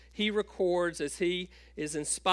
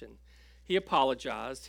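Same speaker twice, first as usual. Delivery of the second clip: normal talking voice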